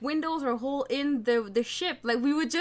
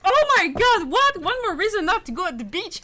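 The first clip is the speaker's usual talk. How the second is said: shocked friend; 6 semitones higher, 7 dB louder than usual. Retold or imitated. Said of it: "He's like".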